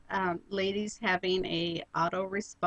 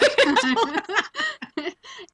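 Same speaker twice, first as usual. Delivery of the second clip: loud high laugh; 13 semitones higher, 8 dB louder than usual. Real, not acted. Big laugh.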